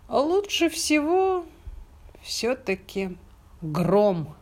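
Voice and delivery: high, slow drowsy hen; sleepy hen thinking. Acted. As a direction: muse it half asleep. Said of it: "подумала она и заснула".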